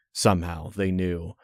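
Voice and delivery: plain reading voice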